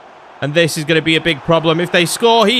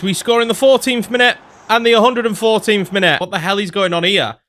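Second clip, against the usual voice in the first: normal talking voice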